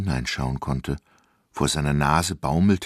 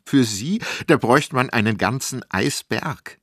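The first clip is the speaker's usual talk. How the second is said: laughing kiosk lady; 6 semitones higher, 5 dB louder than usual. Acted. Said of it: "Frau Meier lachte".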